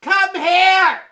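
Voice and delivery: loud call across the restaurant; hollering at the waiter; shout. Acted. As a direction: yell for the waiter.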